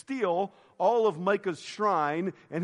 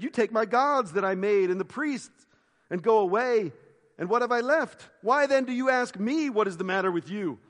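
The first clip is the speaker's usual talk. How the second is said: louder, higher voice of aggrieved complaint; indignant protest; 4 semitones higher, 5 dB louder than usual. Acted. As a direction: read it as a robbed man's heated protest. Acted protest of robbed Micah.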